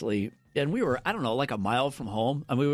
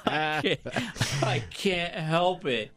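real laughing, words drawn out; helpless laughter; much slower than usual. Real, not acted. Admitting the endless car prank on the wife, helplessly laughing. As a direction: confess it slowly through real laughter.

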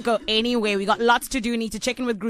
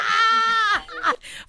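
loud laugh; 12 semitones higher, 4 dB louder than usual. Real, not acted. Laughing at caller's story.

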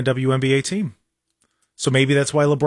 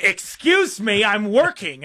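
mock-offended, higher and drawn out; indignant coworker imitation; 5 semitones higher, slower than usual. Host imitating Christine turning around, indignantly stretched and higher. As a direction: mimic a coworker huffily objecting to an interruption. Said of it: "and she went".

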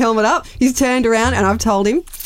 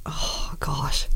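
hushed, breathy "oh gosh" under the breath; nervous whisper; 6 semitones lower, 9 dB quieter than usual. Real, not acted. Nervous driver whispering to herself before calling out. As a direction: whisper it like you're scared to be heard.

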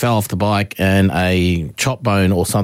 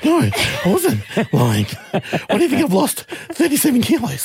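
laughing retort; 13 semitones higher than usual. Retold or imitated. Retorting to mum while laughing.

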